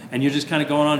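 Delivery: normal talking voice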